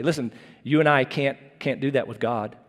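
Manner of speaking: normal talking voice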